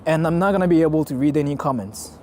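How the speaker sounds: ordinary talk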